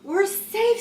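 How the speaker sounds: high, loud exclamation of relief; relieved cry. A narrator's reading. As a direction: call it out in relief. Bat relieved after the rescue.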